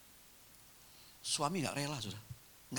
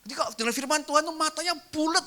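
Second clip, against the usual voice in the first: high, loud and thrilled; excited exclaim; 8 dB louder than usual. Real, not acted. Preacher to congregation, praising an eager listener, thrilled and loud. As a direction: burst out with delight. Preacher's punchline about round eyes.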